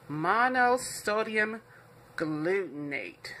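"Monosodium glutamate" drawn out slowly, word by word; slow label reading. Shown as a demonstration. Cook showing viewers what the seasoning label says, read out slowly. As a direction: stretch out "Monosodium glutamate" slowly, falling at the end.

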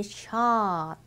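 シャーッ with the vowel stretched long; long held vowel. Shown as a demonstration. Teacher showing the right long シャーッ. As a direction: hold the あ out fully. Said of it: "しっかり伸ばしてくださいね".

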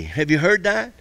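ordinary talk, nothing marked

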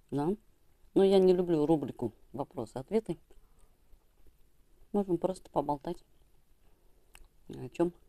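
talking while eating fish; muffled words between bites; mouth full, chewing. Real, not acted. Seen 2: Repeatedly puts fish pieces in mouth, chewing while talking.

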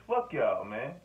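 ordinary delivery

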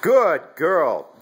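high, loud drawn-out praise; dog praise. Real, not acted. Owner praising puppy for letting go of the bird, high and loud. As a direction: reward her with big happy praise.

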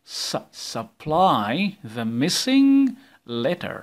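MISSING drawn out slowly with a rise; stressing missing. Shown as a demonstration. Teacher reading the exercise title slowly to pupils. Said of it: "خلي بالكم من العنوان ده".

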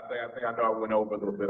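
ordinary talk

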